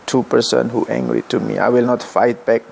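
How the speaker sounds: ordinary talk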